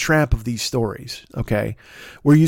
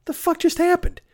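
stunned disbelief; 11 semitones higher, 3 dB quieter than usual. Retold or imitated. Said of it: "And I was like".

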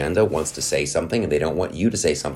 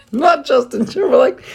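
very high squeaky falsetto; comic falsetto correction; 4 dB louder than usual. Pretend. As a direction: squeal it in a mock-silly high voice.

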